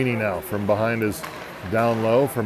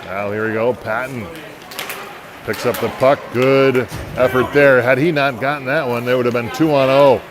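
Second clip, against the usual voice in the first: brisk hockey announcer calling the action; live play-by-play. Acted. Announcer calling the hockey game for listeners, in brisk play-by-play. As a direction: call the game as it happens, rising on big plays.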